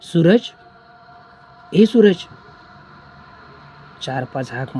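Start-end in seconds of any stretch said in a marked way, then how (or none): 0.0-2.1 loud, high call to a friend's house. Acted. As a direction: call the name out, raised and carrying. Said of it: "सूरजाक हाक मारूक चालू केल्या". (मोठ्याने हाक मारणे)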